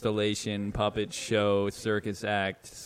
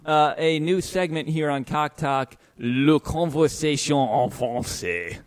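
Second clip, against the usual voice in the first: loud, higher show announcer; 5 semitones higher, 3 dB louder than usual. Pretend. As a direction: announce it like a host. Announcing a new segment.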